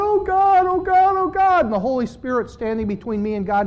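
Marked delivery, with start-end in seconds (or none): 0.0-1.7 high, loud pleading cry; desperate cry to God. Acted. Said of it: "I'm going".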